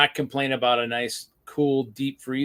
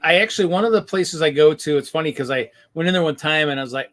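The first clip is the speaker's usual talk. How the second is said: ordinary talk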